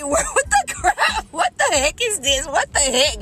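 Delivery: laughing disbelief. Real, not acted. Baffled outburst with laugh.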